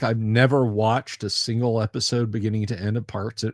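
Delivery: normal talking voice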